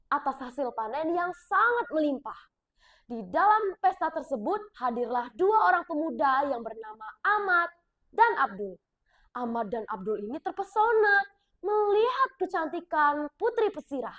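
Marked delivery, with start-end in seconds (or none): none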